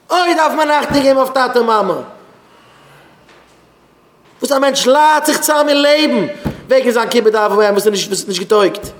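loud, high rebuking sermon; shouted exhortation, high and insistent; full-force preaching. Real, not acted. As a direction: thunder the admonition at the congregation.

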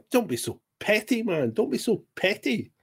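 usual speaking voice

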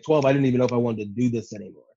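usual speaking voice

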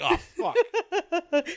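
high laughing cry; laughing mock dismay. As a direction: squeal it out through a laugh.

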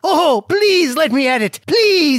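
high, loud pleading; desperate eager plea; eager begging. Acted. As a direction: beg to be picked, overeager. Begging to read letter.

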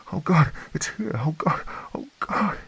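high, whispered panicked crying; terrified whisper. A narrator's reading. Woman outside whispering as the monster comes, terrified. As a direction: whimper in terror.

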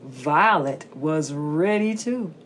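usual speaking voice

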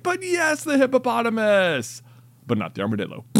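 high squeal on "yes", punchline drop; dramatic storybook read. Shown as a demonstration. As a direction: squeal it, then land the twist. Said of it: "it's like".

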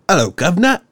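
loud, high mock-British greeting; British accent. Host mimicking the trailer's character, in British accent. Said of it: "he's all like".

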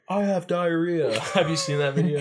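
mock victim. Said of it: "They're like".

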